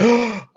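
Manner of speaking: high, loud mock gasp; sharp theatrical intake of breath; dramatic gasp. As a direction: gasp like the world just ended. Posting a plea on Facebook.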